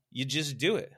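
loud, punchy imitation of a motivator; motivational speaker's answer. Speaker answering a worker's problem, loud motivator voice. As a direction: fire back a simple command. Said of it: "He's like".

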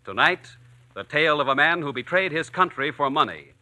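ordinary talk

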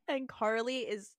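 ordinary talk, nothing marked

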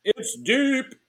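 high, loud ballpark announcer; excited announcer call. Pretend. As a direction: sell the home run to the crowd. Baseball home run call.

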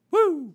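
high, loud wrestler's whoop; Ric Flair woo. Retold or imitated. Host after naming his wrestling hero, high catchphrase whoop. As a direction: let out a showman's ringing "woo".

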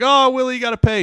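loud, high gloat; gloating glee. Retold or imitated. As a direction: rub it in gleefully. Gloating at Willie.

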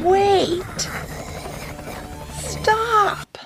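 very high, drawn-out shouted command; commanding holler. A narrator's reading. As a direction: holler it high to halt everyone. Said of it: "hollered at everyone in his most commanding voice".

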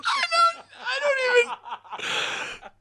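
high, laughing too hard to finish; breaking up laughing mid-sentence; helpless laughter. Real, not acted. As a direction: squeeze the words out through real laughter. Helpless laughter at the replayed scream.

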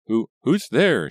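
slow, stammering, scared and high; frightened stammer. Acted. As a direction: stammer it out in fear. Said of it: "she managed to stammer".

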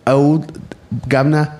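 self-mocking bad British accent. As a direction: attempt a cockney British voice and miss. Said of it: "I can't do a British accent".

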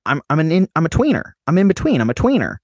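a hint of Silas Howard saying he's a tweener; imitating Silas Howard. Quoting Silas Howard from the film, light imitation. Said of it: "That was really accurate".

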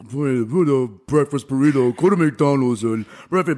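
imitating a griping, grouchy cook; slow, mumbled mock bitching under the breath; grouchy grumbling. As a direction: grumble it like a fed-up short-order cook. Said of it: "This guy couldn't stop bitching about it".

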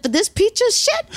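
squeaky incredulous, pitch shooting up an octave. Retold or imitated. Buying a friend's burnt car, high incredulous squeak. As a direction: balk at the price in disbelief. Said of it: "I said".

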